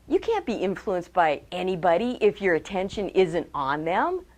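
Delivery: ordinary talk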